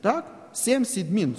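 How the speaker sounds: ordinary talk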